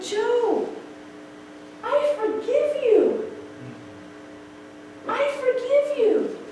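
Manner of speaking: high, slow, emotional reassurance; re-enacted tender pardon, pitched high and unhurried; tender forgiveness. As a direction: draw it out high and heartfelt. Re-enacts forgiving her weeping brother.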